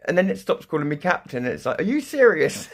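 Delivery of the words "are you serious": high, loud disbelieving question; mock outraged computer; incredulous. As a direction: ask it in total disbelief.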